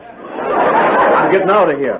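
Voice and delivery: slow, frightened with a nervous laugh; panicked. Acted. Andy fleeing the strange bed, frightened. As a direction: bolt in fright.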